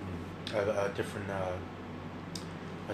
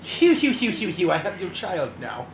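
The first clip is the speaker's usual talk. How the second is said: high laughing villain taunt; evil villain; 6 semitones higher than usual. Pretend. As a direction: gloat like a cartoon villain. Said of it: "the evil villain".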